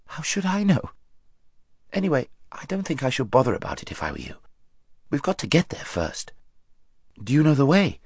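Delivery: lightly voiced young boy. A narrator's reading. Shasta answers Bree.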